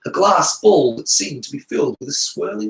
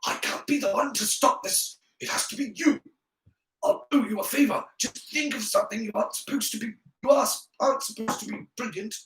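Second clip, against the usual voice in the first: higher, soft urgent whisper; 7 semitones higher, 6 dB quieter than usual. A narrator's reading. Draco pressing Harry to stop the fight, whispering. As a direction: plead under your breath. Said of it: "whispered Draco".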